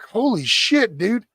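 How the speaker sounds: shocked. Retold or imitated. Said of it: "we're like".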